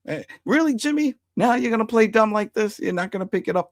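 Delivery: mock incredulous. Recapper voicing Glenn needling Jimmy in the car, high mock disbelief. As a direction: tease him for playing dumb.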